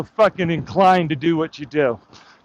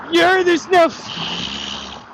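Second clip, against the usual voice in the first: stretched mock taunt; 9 semitones higher, much slower than usual. Real, not acted. Taunting a heckler.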